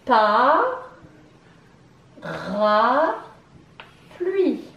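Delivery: "Pa, ra, pluie" drawn out with long pauses; syllable by syllable. Shown as a demonstration. Teacher coding syllables with the class, "Pa, ra, pluie" stretched apart. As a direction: stretch and separate each beat of "Pa, ra, pluie". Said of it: "nous allons coder les syllabes".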